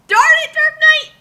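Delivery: high, loud mock yell; mock outburst. Friend scolding Dark Knight, loud mock yell. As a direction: shout in comic frustration.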